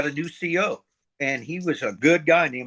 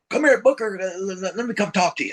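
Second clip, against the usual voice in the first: higher-pitched imitation of an officer; chief warrant officer; 4 semitones higher than usual. Warrant officer calling the new sergeant over, higher mock voice. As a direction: call him over in a put-on, raised voice. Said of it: "chief warrant officer come".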